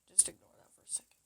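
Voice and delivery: fast whispered aside; hurried whisper. Real, not acted. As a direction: brush it off in a quick whisper.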